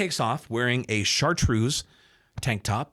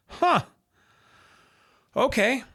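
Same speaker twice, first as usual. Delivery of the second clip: surprised; 13 semitones higher, 4 dB louder, slower than usual. Retold or imitated. Sees himself on front page.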